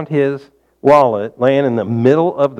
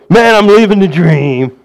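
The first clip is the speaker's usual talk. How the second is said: sarcastic; 4 semitones higher, 5 dB louder, slower than usual. Retold or imitated. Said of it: "They're being sarcastic".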